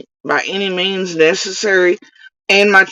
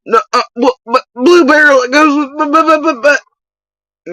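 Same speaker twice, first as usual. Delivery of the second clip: much higher, stammering mock of a flustered woman; 11 semitones higher, 4 dB louder than usual. Mocking the rival when shown receipts, high stammering voice. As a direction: sputter the words in a high ditzy voice like caught out. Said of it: "and she would be like".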